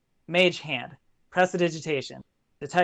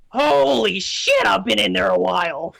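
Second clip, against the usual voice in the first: imp stretching. Acted. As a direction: groan it out as a cramped little imp. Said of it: "and he's like".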